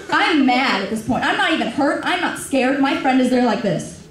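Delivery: usual speaking voice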